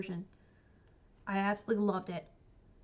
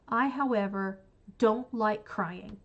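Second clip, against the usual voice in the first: drawn out, every word spaced; emphatic slow aside; 3 dB louder, slower than usual. Real, not acted. Telling viewers she hates sad books, slow and pointed. Emphatic turn after praising book.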